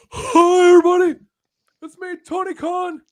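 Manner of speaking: very high, over-excited mock greeting; Tony Khan. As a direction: shout it in a silly high-pitched voice. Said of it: "He's like".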